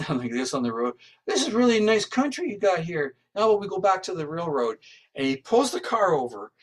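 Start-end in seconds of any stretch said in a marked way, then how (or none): 1.3-4.8 higher-pitched sightseer's delight; acted chirpy enthusiasm. Passenger to detective halfway through the ride, chirpy mock enthusiasm. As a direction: play the delighted passenger, voice lifted.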